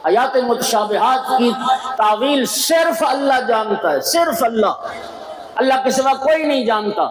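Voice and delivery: high, ringing pulpit cry; fiery majlis preaching with the pitch thrown high; preaching at full force. Acted. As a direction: proclaim it to the whole gathering. Preacher raises voice at climax.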